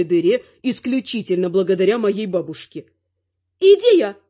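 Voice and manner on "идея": high, loud burst of inspiration; excited old lady, voice leaping up; exclaiming granny. A narrator's reading. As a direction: cry out with a sudden idea. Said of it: "воскликнула бабуля".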